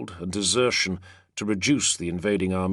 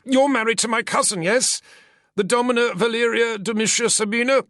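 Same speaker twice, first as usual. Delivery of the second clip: high, anxious eager questioning; anxious senator; 5 dB louder than usual. Acted. Senator eagerly asks his captor about his wife. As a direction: press the connection hopefully.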